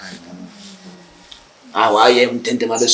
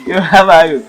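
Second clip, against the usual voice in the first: high, loud swaggering hello; loud greeting; 9 semitones higher, 11 dB louder than usual. Acted.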